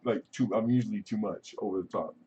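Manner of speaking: ordinary talk, nothing marked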